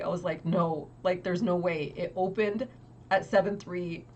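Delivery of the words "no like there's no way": normal talking voice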